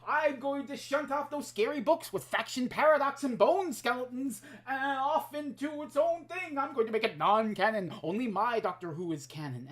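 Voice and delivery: mock squabbling fan. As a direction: ridicule a petty gatekeeper. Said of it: "fan squabbling over which line is the canon".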